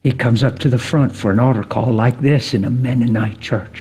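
normal talking voice

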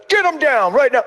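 imitated angry-parent order; stern dad order. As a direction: act out a father demanding obedience. Drinking dad orders child, acted.

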